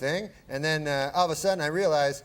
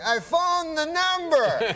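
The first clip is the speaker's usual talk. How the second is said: high, very slow Cosby drawl; Bill Cosby impression; 12 semitones higher, slower than usual. Guest doing Cosby for the host, high and slow. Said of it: "And he's like".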